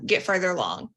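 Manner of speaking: ordinary delivery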